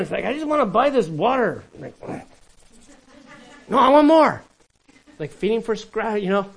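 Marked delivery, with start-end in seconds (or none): 0.3-1.6 high, loud mock craving customer; gambler voiced. Preacher mimics a gambling addict, loud and high. 3.7-4.4 high, loud mock demand for more; greedy shout. Preacher mimics an addict yelling for more, loud and high.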